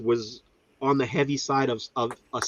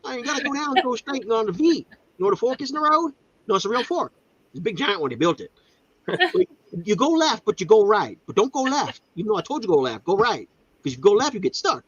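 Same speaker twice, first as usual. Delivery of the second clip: exasperated; 9 semitones higher than usual. Retold or imitated. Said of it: "I'm like".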